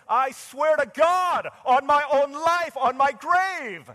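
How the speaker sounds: full-voiced high shout, drawn out slowly; violent swearing. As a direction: bellow the oath, stretching each word. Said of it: "and he swore to them".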